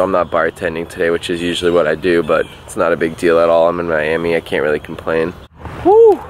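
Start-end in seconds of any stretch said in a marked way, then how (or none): 5.8-6.2 excited whoop. Real, not acted.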